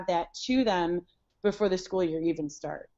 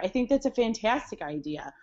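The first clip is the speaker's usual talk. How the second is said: usual speaking voice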